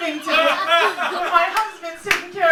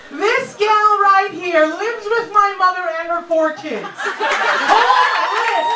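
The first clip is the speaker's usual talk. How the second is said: indignant shout; 6 semitones higher, 8 dB louder than usual. Real, not acted. Indignant outburst about the woman.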